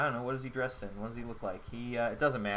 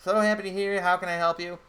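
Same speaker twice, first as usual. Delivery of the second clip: higher, louder eager desk clerk voice; a higher, louder put-on clerk greeting; chirpy hotel clerk; 8 semitones higher, 8 dB louder than usual. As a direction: greet her like an over-eager hotel receptionist. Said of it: "I shouldn't do voices".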